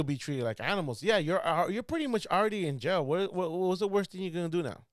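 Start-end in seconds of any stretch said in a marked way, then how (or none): none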